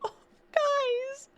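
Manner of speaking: high-pitched plea, close to laughter; overwhelmed appeal to the audience; real helpless amusement; laughing disbelief. Real, not acted. Overwhelmed by the absurd lore.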